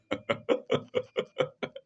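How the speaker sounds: long run of breathy chuckles; amused chuckle. Real, not acted. Lecturer amused at Abraham's silence, real amused chuckle. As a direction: chuckle away to yourself.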